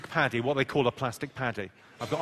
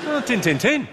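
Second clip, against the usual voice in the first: high, loud, exclaimed Yorkshire "tin, tin, tin"; Yorkshire accent; 7 semitones higher, 7 dB louder than usual. Shown as a demonstration. Comic milking the Yorkshire phrase, loud high Yorkshire accent. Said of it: "My favourite Yorkshire phrase".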